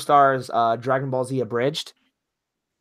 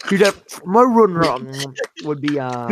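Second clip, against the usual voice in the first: mock mouth full; 2 semitones higher, 5 dB louder than usual. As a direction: mimic talking through a mouthful, exaggerated. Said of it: "Are you eating right now, bro?".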